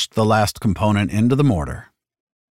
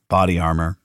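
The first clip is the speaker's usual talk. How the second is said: ordinary delivery, nothing marked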